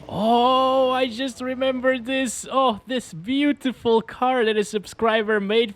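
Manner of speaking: light up at a happy rediscovery; delighted recognition. Real, not acted. Delighted at rediscovering a gifted car.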